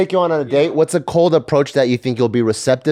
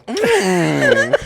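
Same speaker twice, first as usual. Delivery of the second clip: ordinary delivery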